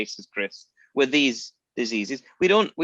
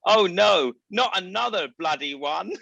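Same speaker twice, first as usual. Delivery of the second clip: imitating Brenda; 7 semitones higher, 3 dB louder, slower than usual. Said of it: "in her Bristolian accent, which I won't try to do".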